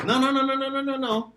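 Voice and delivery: high, rapid no, no, no; playful teacherly refusal; emphatic no. Real, not acted. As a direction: cut them off, eager to correct. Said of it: "teacher correcting".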